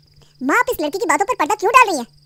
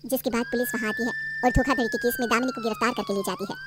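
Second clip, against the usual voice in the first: low, calm closing narration; story narration; 3 semitones lower, 6 dB quieter than usual. A narrator's reading. Narrator telling how police arrest Damini, calm narration. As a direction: wrap up the story evenly.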